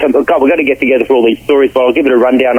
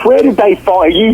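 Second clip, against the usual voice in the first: imitated co-star; 7 semitones higher than usual. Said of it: "that's what he goes".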